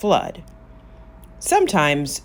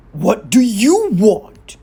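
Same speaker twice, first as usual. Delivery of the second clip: slow, booming giant, louder and higher; giant's voice; 4 semitones higher, 9 dB louder, slower than usual. Acted. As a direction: loom over them with a slow boom. Giant greets visitors at table.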